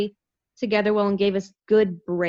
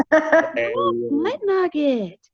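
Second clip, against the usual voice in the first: shocked gasp; 7 semitones higher, much slower than usual. Real, not acted. Mock outrage at Tom.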